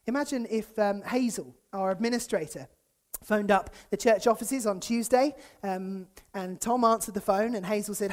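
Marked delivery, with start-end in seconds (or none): none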